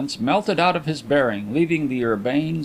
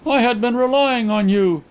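reproachful grumble; 10 semitones higher, 5 dB louder than usual. A narrator's reading. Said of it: "he grumbled reproachfully".

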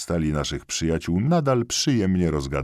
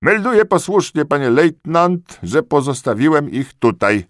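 high, loud servile report; eager corporal; 11 semitones higher, 9 dB louder than usual. A narrator's reading. Corporal reporting to the lieutenant, high and eager.

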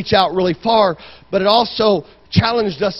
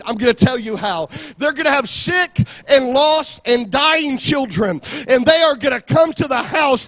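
raised, emphatic sermon delivery; impassioned preaching; 6 semitones higher than usual. Real, not acted. Preacher telling the church how to reach the world, impassioned preaching. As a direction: drive each promise home to the congregation.

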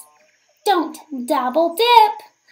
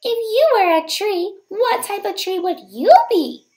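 usual speaking voice

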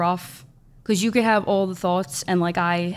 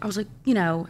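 ordinary delivery

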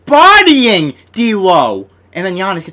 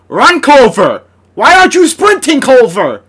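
higher and louder, exasperated; frustrated yell; 8 semitones higher, 8 dB louder than usual. Real, not acted. Gamer to a jogging player, an exasperated shout. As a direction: shout at him to sprint. Yelling at slow teammate.

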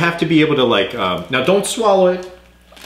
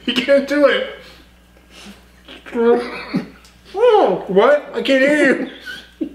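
laughing; 11 semitones higher than usual. Real, not acted. Host laughing at stuffed player.

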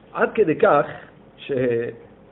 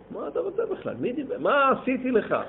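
retold angry yelling; 9 semitones higher, 3 dB quieter than usual. A stranger berates the silent rabbi at the Wall, mock angry. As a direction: play the stranger lashing out at the rabbi, but lightly. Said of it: "צועק עוד".

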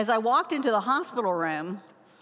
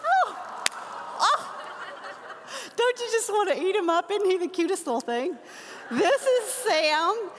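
doting coo; 9 semitones higher than usual. Real, not acted. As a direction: coo over a new grandbaby. Cooing over grandson's photo.

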